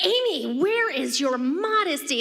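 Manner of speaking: a touch of high, scolding mother; scolding mom. Retold or imitated. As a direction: chide lightly like mom catching a naked toddler. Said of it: "Mom's like".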